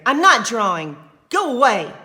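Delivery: loud sneering dismissal; loud angry brother sneer. Acted. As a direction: push her away with the voice. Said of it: "Ramon sneered".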